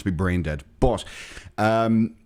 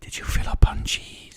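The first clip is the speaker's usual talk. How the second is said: hushed scolding mom; whispered scolding. Acted. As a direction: whisper it like a suspicious parent.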